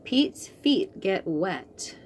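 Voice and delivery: plain reading voice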